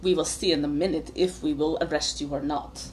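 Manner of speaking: low, soft voice of authority; lightly voiced menacing lawman, pulled in close; stern guard Izek.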